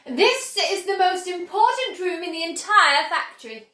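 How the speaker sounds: proud showman confiding a secret; excited factory owner; Willy Wonka. Acted. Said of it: "he said".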